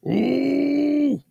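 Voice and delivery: high, drawn-out yell of a name; anguished mock scream. A man raging at his rival, drawn-out mock scream. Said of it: "Captain Kirk screaming at Khan".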